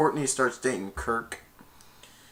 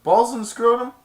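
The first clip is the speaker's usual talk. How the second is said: mock announcer; 10 semitones higher, 7 dB louder than usual. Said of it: "they said".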